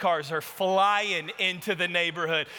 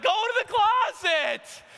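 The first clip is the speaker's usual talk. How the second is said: higher, slow, urgent shout; shouted order; 12 semitones higher, 3 dB louder, slower than usual. Retold or imitated. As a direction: bark the order at the wife following behind. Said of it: "I'm like".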